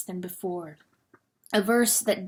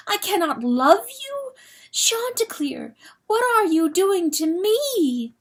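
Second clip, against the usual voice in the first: distraught; 10 semitones higher than usual. Acted. Hen distraught at rooster.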